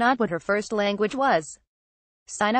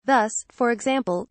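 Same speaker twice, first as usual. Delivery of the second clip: usual speaking voice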